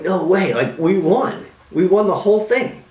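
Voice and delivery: excited no-way cheer; thrilled disbelief. Retold or imitated. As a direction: burst out with joy at winning. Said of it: "I'm like".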